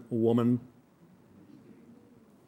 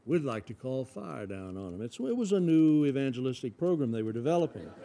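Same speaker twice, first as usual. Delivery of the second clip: ordinary delivery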